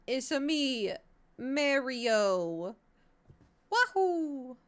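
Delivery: slow, high Italian plumber voice; mock Mario cry; Mario impression. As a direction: ham up the plumber hero. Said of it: "Mario, but Mario".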